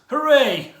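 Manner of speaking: high, loud sarcastic hooray; short falling cheer, tongue-in-cheek; mock cheer. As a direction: cheer the death insincerely. Mock cheer when he dies.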